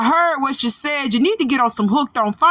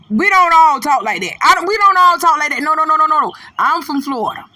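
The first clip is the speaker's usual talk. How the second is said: raised, louder denial; emphatic protest; 4 semitones higher, 4 dB louder, faster than usual. Real, not acted. Speaking for all Floridians, an emphatic, higher-pitched denial. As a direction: protest it hard, pitch up, repeating yourself. Disowning [name]'s way of talking.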